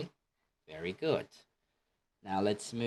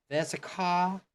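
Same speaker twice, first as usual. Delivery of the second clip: higher, posh-sounding British put-on; British accent; 9 semitones higher than usual. Shown as a demonstration. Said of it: "But in British".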